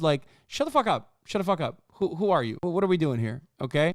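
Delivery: ordinary delivery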